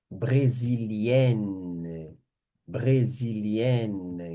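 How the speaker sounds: Brésilienne drawn out very slowly with the final -enne clearly sounded; slow feminine form. Shown as a demonstration. Teacher modelling the feminine nationality for students, very slowly. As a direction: spell out Brésilienne syllable by syllable.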